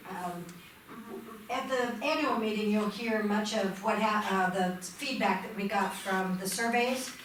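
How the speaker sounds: ordinary delivery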